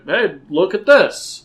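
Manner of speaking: slow, hammed-up look at this; mock excitement. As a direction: hold up the card like a proud kid. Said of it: "that card was just like a".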